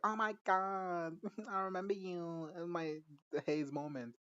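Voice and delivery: put-on slow, high, giggly drunk mom. Recapping a show, voicing the drunk mom slowly. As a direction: slur it like a tipsy mom, up high.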